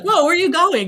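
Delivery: startled call. Retold or imitated. Parents calling after son heading into shop, startled. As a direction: call out in alarm as he walks off.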